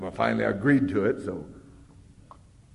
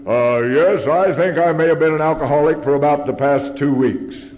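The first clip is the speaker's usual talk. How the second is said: loud, grandly enunciated reply; mock announcer; 4 semitones higher, 9 dB louder than usual. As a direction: answer in kind like a TV announcer. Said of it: "I'd better answer him in kind".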